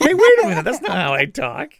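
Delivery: mock protest. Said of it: "And I'm like".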